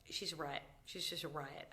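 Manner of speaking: usual speaking voice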